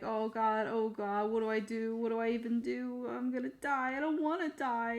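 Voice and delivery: high, rising panicky babble; hammed-up frightened little gnome; acted panic. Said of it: "he's panicking".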